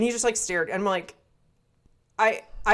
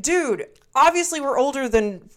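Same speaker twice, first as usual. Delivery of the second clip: exasperated; 6 semitones higher, 4 dB louder than usual. Retold or imitated. Retold exasperated quote.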